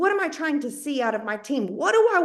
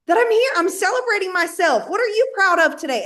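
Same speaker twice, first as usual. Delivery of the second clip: high, louder, upbeat announcement; proud celebration; 8 semitones higher, 5 dB louder than usual. Acted. Posting to celebrate herself, high and exuberant. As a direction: act out a proud post, pitched well up.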